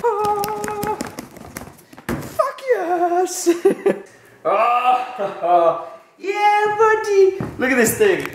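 high delighted squealing; excited squeal. Real, not acted. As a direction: squeal with real joy. Package arrived, delighted.

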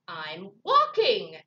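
loud, slow irritated snap; snapped. Acted. Grumpy Bird answering Raccoon, snapped and loud. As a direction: snap it, leaning hard on WALKING. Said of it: "snapped Bird".

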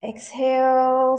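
long, loud, stretched exhale cue; sustained yoga teacher's cue; drawn-out breathing cue. Real, not acted. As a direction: draw the word out for a whole breath. Leading the class's breathing.

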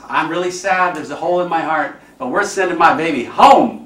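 a mother's sad words turning triumphant; grieving mother, rising. Retold or imitated. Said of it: "She goes".

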